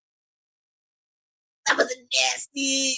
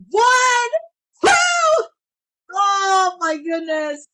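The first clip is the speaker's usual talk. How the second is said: high shouted count, stunned; excited shout; 5 semitones higher, slower than usual. Real, not acted. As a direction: shout the count, then gasp it out. Near fall count.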